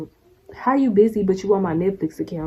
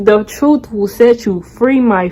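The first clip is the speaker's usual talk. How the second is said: loud slow preaching; 2 semitones higher, 9 dB louder, slower than usual. Real, not acted. Preaching at liar.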